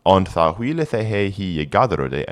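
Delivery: put-on careful Old English recitation. Shown as a demonstration. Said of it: "reading Old English text aloud".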